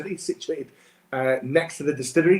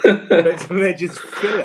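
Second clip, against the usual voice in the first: laughing; 4 semitones higher, 5 dB louder, slower than usual. Real, not acted. Laughing at the misheard joke.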